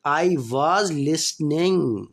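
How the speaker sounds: slow, clear dictation pace; slow classroom example sentence; slow example read. Shown as a demonstration. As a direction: spell it out slowly for students. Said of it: "teacher reading tense example".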